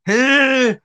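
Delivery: high, loud mock squeal; squealed, drawn-out "hey"; giddy squeal. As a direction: let out a comic high-pitched yelp. Acting out Clouseau giggling on gas.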